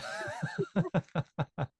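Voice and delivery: long amused laugh; a real laugh at the jargon. Real, not acted. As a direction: laugh it off, drawn out. Laughing at the question.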